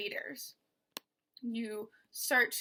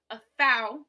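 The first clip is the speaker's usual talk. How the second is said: A light, thou much louder; stress on thou; 10 dB louder than usual. Shown as a demonstration. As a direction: land hard on thou. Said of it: "is unstressed".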